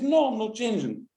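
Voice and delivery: high, firm no; stubborn refusal. Retold or imitated. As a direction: plant your feet and refuse. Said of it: "I says".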